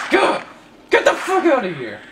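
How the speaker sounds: high, loud yelp into angry shouting; angry outburst. Real, not acted. Gamer telling an enemy to get out, angry shout.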